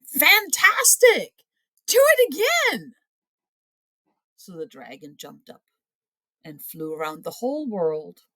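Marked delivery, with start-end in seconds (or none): 0.1-2.9 loud, much higher, slow cheer; shouted. A narrator's reading. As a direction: egg him on at full voice. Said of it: "Elizabeth shouted".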